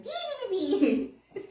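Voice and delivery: high thin chirping beeps; bird chirp imitation. Bird flying over the friend's head, high chirps.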